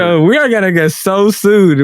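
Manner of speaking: ordinary talk, nothing marked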